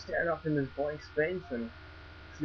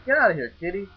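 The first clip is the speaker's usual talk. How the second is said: higher, louder shout at the cat; shooing shout; 7 semitones higher, 6 dB louder, faster than usual. Real, not acted. Shooing the cat away from the car, sharp and loud. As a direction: shoo the kitty off the car.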